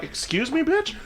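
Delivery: high-pitched indignant comeback; hammed-up incredulous question; mock offended. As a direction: act affronted, pitch leaping up at the end. Acted offended reply to insult.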